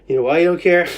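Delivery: forceful low taunting question; male voice from the other side. Shown as a demonstration. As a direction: challenge them in a loud male voice. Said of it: "I'm doing the voice on the other side".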